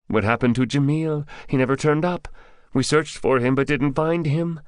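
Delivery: high-pitched captive's voice; high, anxious underling voice; questioning captive. Acted. Captive's figure in narrated story.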